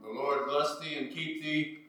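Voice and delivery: ordinary delivery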